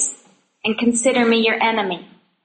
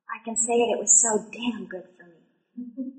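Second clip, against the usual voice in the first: much softer, heartfelt and emphatic; quiet intensity; 8 dB quieter than usual. Real, not acted. Testifying to the women about her hard years, hushed. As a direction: confide it with hushed conviction.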